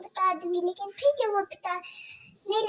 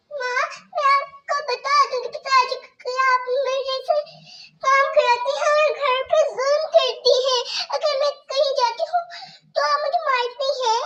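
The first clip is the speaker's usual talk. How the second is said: put-on much higher, small pleading girl voice; pleading young girl; 6 semitones higher, 4 dB louder than usual. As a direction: Kajal begging her stepmother about stomach pain. Kajal threatens to tell.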